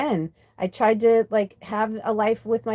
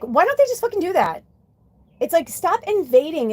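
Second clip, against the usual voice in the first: frustrated outburst; 7 semitones higher than usual. Real, not acted. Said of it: "I just got so frustrated".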